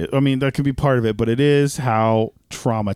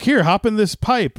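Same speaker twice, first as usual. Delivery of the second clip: eager Toad; 8 semitones higher than usual. Retold or imitated. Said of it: "it's all him being like".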